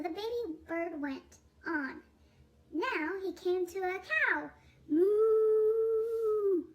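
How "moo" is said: loud, long sung moo; cow moo. Acted. Cow in picture book.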